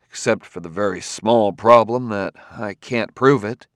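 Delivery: plain reading voice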